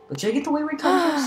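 high, put-on vendor voice; shopkeeper voice. Reads shop item in voice.

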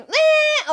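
high, loud "eh" noise; high-pitched mocking fangirl noise; mock swooning squeal. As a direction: imitate women going silly over boys. Mimics women fawning over boys.

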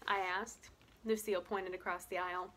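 plain reading voice

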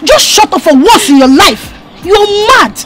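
high, heated taunting; indignant, high-pitched jeering; scornful retort. Acted. Woman insults the angry husband back.

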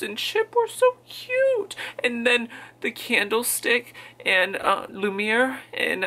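high slow cooing over cute things; gushing. Real, not acted. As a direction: gush like it's adorable. Gushing about cute characters.

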